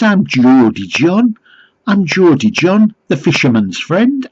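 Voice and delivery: high, loud, hammed-up Geordie; mock Geordie accent. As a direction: proudly announce yourself in put-on Geordie. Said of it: "I don't think it was a genuine accent".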